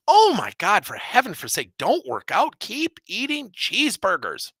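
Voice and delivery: hammed-up high, loud fake encouragement; mock permissive advice. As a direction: oversell the bad advice sarcastically. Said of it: "would you really tell someone".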